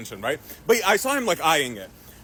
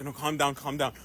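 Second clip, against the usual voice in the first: soothing; 6 semitones lower, 7 dB quieter than usual. Retold or imitated. Husband soothing the angry dad.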